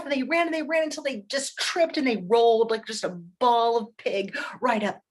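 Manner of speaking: usual speaking voice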